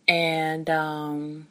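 usual speaking voice

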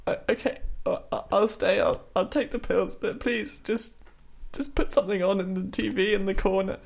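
put-on giving in weakly, a frail old man; defeated old Alan Rickman. To the carers, old Rickman giving up and asking for TV. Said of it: "nailing, uh, old Alan Rickman".

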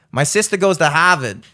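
amateur Boston accent, dropped R in Harvard; attempted Boston accent. Shown as a demonstration. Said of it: "can't really do a Boston accent".

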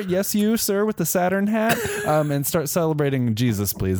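high, prim telling-off; high, prissy reprimand; mock scolding. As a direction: scold the man in the Saturn hat. Scolding a pagan reveller.

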